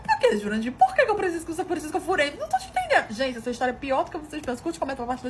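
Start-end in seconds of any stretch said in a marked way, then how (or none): 0.0-3.1 loud, high-pitched bewildered protest; incredulous questioning. Retold or imitated. Wife demanding an explanation from Jurandir, incredulous. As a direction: act out outraged confusion, climbing higher. Esposa questiona o marido, confusa.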